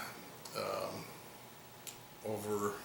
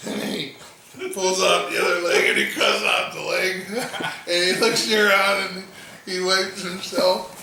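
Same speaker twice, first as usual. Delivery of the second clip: laughing; 5 semitones higher, 10 dB louder than usual. Real, not acted. Laughing while retelling the climax.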